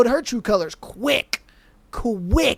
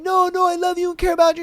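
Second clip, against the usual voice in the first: mock sweet Casey; 7 semitones higher, faster than usual. Mocking Casey, fast.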